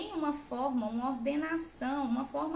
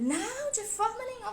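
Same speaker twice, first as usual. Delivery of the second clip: mock scolding; 9 semitones higher than usual. Mother imagining forbidding her son to write, mock-stern. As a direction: play the over-strict mother, half in jest.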